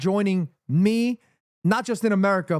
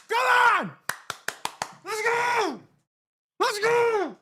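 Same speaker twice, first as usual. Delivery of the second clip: very high, drawn-out yell; rallying cry; 14 semitones higher, slower than usual. Real, not acted. Fan fires up after the anthem, high rallying yell. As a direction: holler it with the vowel stretched.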